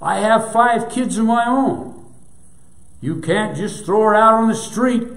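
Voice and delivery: loud, high, desperate shouting. A narrator's reading. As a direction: keep shouting at the owner. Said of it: "the son shouted".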